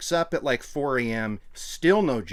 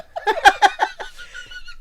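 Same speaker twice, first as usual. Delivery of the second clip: high burst of laughter; 5 dB louder than usual. Real, not acted. Big laugh after impression.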